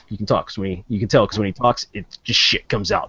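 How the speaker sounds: ordinary talk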